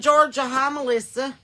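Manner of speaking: excited. Real, not acted. Greeting state.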